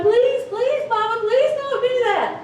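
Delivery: high, loud, frantic begging with a laugh in it; pleading children. Retold or imitated. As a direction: beg mama like scared kids. Kids keep begging mother.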